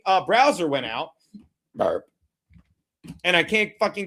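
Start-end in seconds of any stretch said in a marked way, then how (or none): 1.8-2.0 short low burp. Real, not acted. Live streamer burping mid-rant, low burp.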